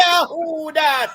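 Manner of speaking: high, drawn-out frightened who that; slow scared call. Retold or imitated. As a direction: stretch it out in fright. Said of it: "When me I say".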